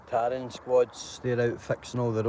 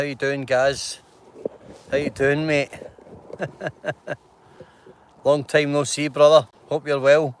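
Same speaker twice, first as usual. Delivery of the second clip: louder, hearty shout-out; calling out; 3 semitones higher, 7 dB louder than usual. Real, not acted. Greeting old friend Gaz on camera, loud and hearty.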